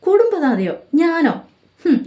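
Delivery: high, mocking echo of the word; sarcastic high-pitched retort; scornful disbelief. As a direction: scoff at the idea bitterly. Mother scoffs at being called head.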